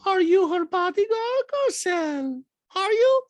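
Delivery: loud, very high needling challenge; mock castmate falsetto. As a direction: taunt with rising squeaky questions. Acted Diana challenging Garcelle.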